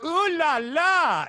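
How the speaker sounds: high, loud, slow drawn-out ooh la la; hammed-up French ooh la la. Luring mall moms with a hammed-up French ooh la la. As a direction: croon it like a cheesy French flirt. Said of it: "I'd just scream in French".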